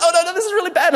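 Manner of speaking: high, laughing mock panic; a girl losing her nerve, played for laughs; panicked girl. Nervous girl at assembly in high laughing mock panic. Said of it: "She's like".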